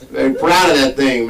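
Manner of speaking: usual speaking voice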